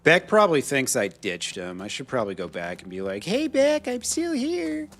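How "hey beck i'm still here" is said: high, drawn-out falsetto call; mock sing-out, held high; pretend calling out. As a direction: call to a friend in a silly high voice. Pretend call to friend Beck.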